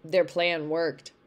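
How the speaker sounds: usual speaking voice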